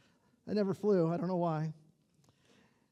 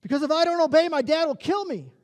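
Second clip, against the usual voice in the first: higher, somewhat louder call from the back; shouted out; 6 semitones higher, 4 dB louder than usual. Retold or imitated. Teenager shouting out in youth class, a mild mock yell. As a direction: blurt it out from your seat. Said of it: "stood up and shouted".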